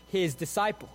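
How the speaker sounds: usual speaking voice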